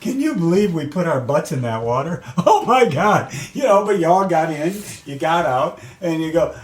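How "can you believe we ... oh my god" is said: higher, amused retold exclamation; laughing disbelief. Athletes laughing together after a tough swim, laughing disbelief. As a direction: crack up as you relive it. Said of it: "sitting around and laugh and go".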